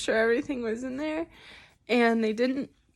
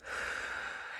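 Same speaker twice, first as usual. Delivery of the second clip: long slow exhale; calming sigh; deep breath out; 12 dB quieter than usual. Real, not acted. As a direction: let the breath go to settle. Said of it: "Take some deep breaths".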